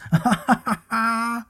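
high amused giggle; cub laughter. Acted. Little bear laughs at the worm, high giggle.

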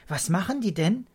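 put-on bright-eyed childlike curiosity; excited child. A narrator's reading. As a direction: ask eagerly like a keen kid. Child excited about spirits.

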